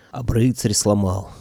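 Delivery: usual speaking voice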